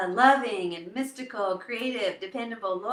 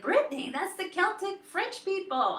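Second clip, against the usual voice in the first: high, imitated excitement; excited friend; 9 semitones higher than usual. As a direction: act out a friend's thrilled discovery. Friend's excited reaction acted out.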